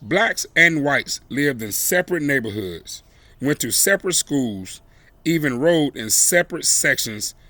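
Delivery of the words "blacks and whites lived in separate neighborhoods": usual speaking voice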